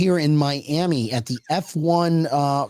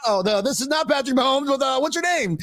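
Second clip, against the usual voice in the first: higher puzzled questioning; mock reporter; 8 semitones higher than usual. Host imitating reporter after the mix-up, puzzled. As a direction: realize the mistake and ask politely. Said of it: "It's like".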